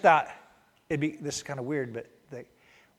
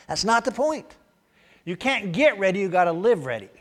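emphatic; 6 semitones higher, 7 dB louder than usual. Shown as a demonstration.

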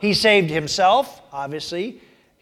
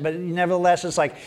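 ordinary delivery

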